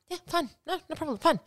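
high, soft, falsely agreeable; mock a hurt partner pretending it's fine; passive-aggressive. Acts out sulky partner refused help.

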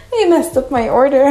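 real upset at the shop; 1 indignant outburst. Real, not acted. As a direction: let the frustration burst out. Upset about ruined gift.

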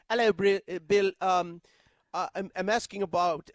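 halting mock British reporter; British accent. Long-winded London reporter questioning the coach, mock British accent. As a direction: hem and haw in a British accent. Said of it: "he's British".

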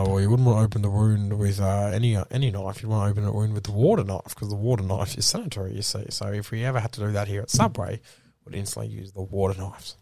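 low, measured company spiel; corporate spokesperson. Acted. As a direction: recite the official policy. Corporate explainer voice again.

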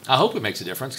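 normal talking voice

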